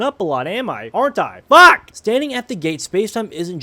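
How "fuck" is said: loud, high frustrated yell; frustrated shout. Real, not acted. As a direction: curse at yourself loudly. Frustrated at own mistakes.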